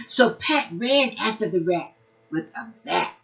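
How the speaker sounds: bright, lively storybook reading; animated read-aloud for preschoolers; reading to children. Acted. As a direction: read it like a picture book to little kids. Reads picture book to children.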